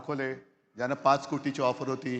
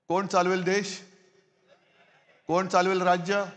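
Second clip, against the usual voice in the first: rally speech; 3 semitones higher, 3 dB louder than usual. Acted. Fiery address to rally crowd.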